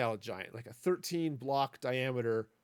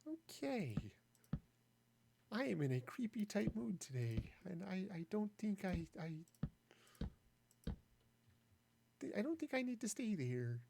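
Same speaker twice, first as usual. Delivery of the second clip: higher, softer creepy voice; softly unsettling, higher up; 5 semitones higher, 7 dB quieter than usual. Pretend. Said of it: "put old creepy voice to bed".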